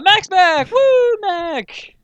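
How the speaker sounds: loud, high-pitched mock celebration; big playful cheer; hammed-up cheer. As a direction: whoop for a returning favorite. Cheering a character's return.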